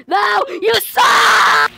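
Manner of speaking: high, loud cries at the game; wailing you suck; frustrated shouting. Real, not acted.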